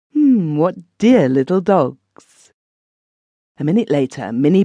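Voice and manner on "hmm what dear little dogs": slow, drawn-out admiring hum; old man musing slowly. Acted. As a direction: savour every word like an old tramp.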